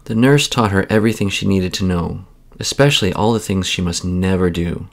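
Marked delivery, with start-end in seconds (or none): none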